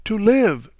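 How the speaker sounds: a mother crying out she wants to live; anguished cry. A narrator's reading. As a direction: throw the words out in despair. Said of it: "she repeated".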